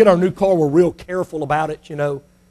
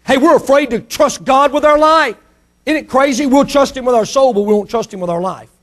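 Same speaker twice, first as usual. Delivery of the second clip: preaching; 6 semitones higher than usual. Acted. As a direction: press the point home. Preacher exhorts.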